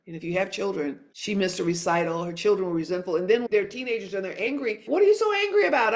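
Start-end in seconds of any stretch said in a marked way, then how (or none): 4.9-5.9 high, fast, loud exasperated cry; exasperated outburst. Retold or imitated. Mother to her angry teenagers, exasperated high shout. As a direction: fire it back at the sulking teen in a burst. Asking angry teens, retold.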